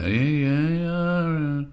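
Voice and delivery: a stretched "aya" for no; drawn-out aya. Shown as a demonstration. Showing again how the long refusing "aya" is done. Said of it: "it's how you do that".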